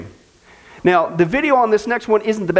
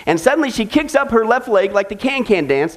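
ordinary talk

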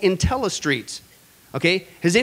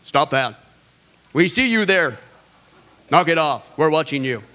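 camera loudspeaker warning; 4 semitones lower than usual. Retold or imitated. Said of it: "installed intercoms on their cameras to warn people".

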